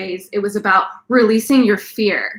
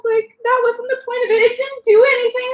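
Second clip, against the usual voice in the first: distressed plea; 12 semitones higher, 3 dB louder than usual. Retold or imitated. Consoling the dead spider.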